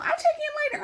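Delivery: very high, singsong self-mockery; mock promise. Vlogger quoting her own empty promise, high mocking voice. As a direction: quote yourself in a squeaky mocking pitch.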